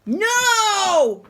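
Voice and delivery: long, loud, high shout; furious scream. A narrator's reading. Teacher cutting off Peter's protest, furious scream. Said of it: "screamed Mister Nurden".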